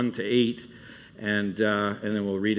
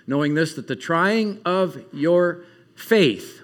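raised, emphatic Bible reading voice; scripture reading; 5 semitones higher, 3 dB louder than usual. Real, not acted. Camp speaker reading James to the campers, raised and emphatic. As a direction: read the verse out strongly to the room.

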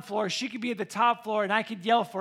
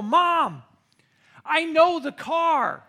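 put-on breathless excited kid; 8 semitones higher, 9 dB louder, much slower than usual. Kid running home to tell mom.

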